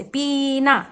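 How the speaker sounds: पीना said slowly with a drawn-out ii; slow teacher's पीना with long ee. Shown as a demonstration. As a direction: stretch the ii in पीना. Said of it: "लंबा खींच के".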